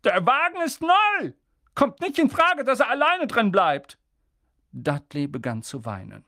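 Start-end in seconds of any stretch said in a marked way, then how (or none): 0.0-4.0 much higher, louder protest; outraged about the new car; upset uncle; indignant uncle. A narrator's reading. Vernon objects indignantly.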